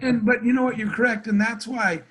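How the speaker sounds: usual speaking voice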